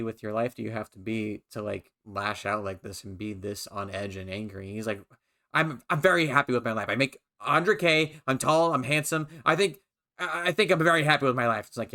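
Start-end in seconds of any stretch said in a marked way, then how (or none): 5.5-11.7 imitated defensive gamer, higher and louder; defensive boasting. Gamer protesting to his teasing teammate, defensive. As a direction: insist on it loudly, mocking his protest.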